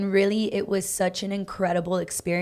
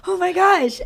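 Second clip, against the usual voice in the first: much higher and louder squeal of delight; thrilled; 12 semitones higher, 9 dB louder than usual. Retold or imitated. Retelling her reaction to signing the choreographer, excited squeal. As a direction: gasp it out like the best news ever.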